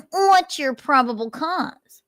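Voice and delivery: Nick's voice, high. Retold or imitated. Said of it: "Nick immediately pushes back and asks".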